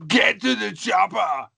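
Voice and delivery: put-on slow shouted Austrian-accented action hero; Arnold Schwarzenegger. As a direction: bark it like Arnold in an action film. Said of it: "Arnold Schwarzenegger's".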